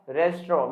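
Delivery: "restaurant" as res-tro, silent ending; preferred pronunciation. Shown as a demonstration. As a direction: drop the nt of "restaurant". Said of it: "जैसे for example". ("restaurant" को 'रेस्ट्रो' बोलें)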